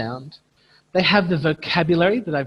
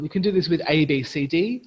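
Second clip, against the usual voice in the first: usual speaking voice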